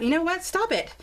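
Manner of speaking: ordinary delivery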